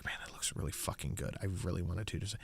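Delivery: hushed, breathy longing for the sandwich; soft greedy whisper; whispered craving. Retold or imitated. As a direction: whisper it like you're drooling over food. Craving while watching food video.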